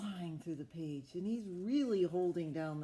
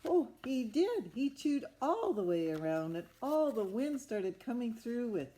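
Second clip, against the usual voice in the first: excited storyteller; 5 semitones higher, 7 dB louder than usual. Acted. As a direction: burst out with delight for the children. Big moment in picture book.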